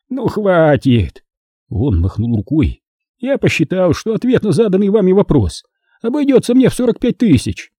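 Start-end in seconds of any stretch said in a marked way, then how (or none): 0.1-1.2 higher, drawn-out, exasperated exclamation; slowly, irritably call a halt; exasperated cut-off. A narrator's reading. Producer cuts off the narrator's needling, exasperated. Messi angrily cuts the mockery off. 3.2-7.7 high-pitched indignant character voice; indignant producer. A narrator's reading. Producer protests what an answer will cost him, indignant.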